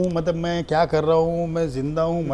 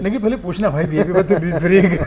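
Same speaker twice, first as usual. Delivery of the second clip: laughing tease; 5 dB louder than usual. Real, not acted. Joking while laughing.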